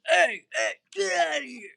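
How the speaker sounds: loud, high retold shout of hey, hey; security guard shouting. Security guard yelling at a patient to leave, loud retold shout. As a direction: bark it out like a guard ordering someone out. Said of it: "and he goes".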